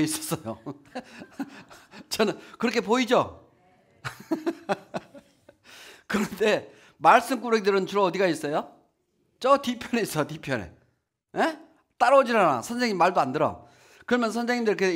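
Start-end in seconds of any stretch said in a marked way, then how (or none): none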